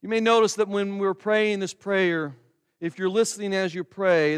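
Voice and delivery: ordinary talk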